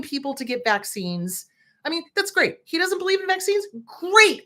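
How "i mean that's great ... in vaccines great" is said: much higher, mocking "Great"; sarcastic. Real, not acted. Mocking RFK's vaccine stance, sarcastic. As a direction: sneer the praise.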